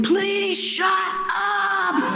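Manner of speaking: drawn-out, high-pitched exasperated yell; loud pleading shout. Retold or imitated. Father on the street corner screaming at his crying baby, loud pleading shout. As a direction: yell it slowly, stretching every word. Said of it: "But it was loud".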